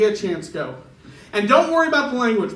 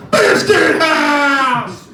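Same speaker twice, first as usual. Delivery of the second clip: hillbilly yell; 6 semitones higher, 7 dB louder, much slower than usual. Retold or imitated. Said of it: "Just yelling at truckers".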